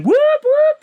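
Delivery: mock fire alarm siren whooping. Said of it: "the fucking fire alarm goes on".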